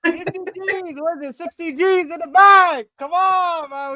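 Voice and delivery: high, loud victory hype; hyped celebration. Real, not acted. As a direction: crow about the big fee like a winning fan. Hyped fan celebrating transfer fee.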